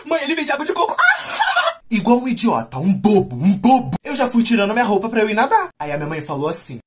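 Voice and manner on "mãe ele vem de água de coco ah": very high-pitched squealing, punctuated by screams; hammed-up overexcited child shrieking at everything; freaking out with excitement. Child seeing the beach, calling to mom, wildly excited squeals. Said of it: "eu surtei. Fiquei assim".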